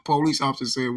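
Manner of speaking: normal talking voice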